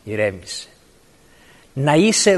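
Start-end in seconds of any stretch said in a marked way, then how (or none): 0.0-0.7 soothing inner voice. Pretend. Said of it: "μία φωνή στα αυτιά σου που σου λέει".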